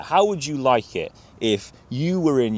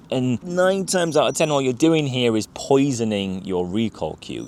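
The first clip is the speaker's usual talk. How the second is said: ordinary talk, nothing marked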